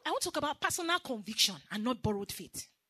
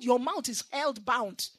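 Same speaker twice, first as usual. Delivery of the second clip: ordinary delivery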